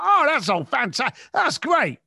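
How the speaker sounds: bright, high fake enthusiasm to match the caller; mock eager cheer; matching his enthusiasm. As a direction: pump up the excitement to mirror him. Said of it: "Match your tonality with the person's enthusiasm".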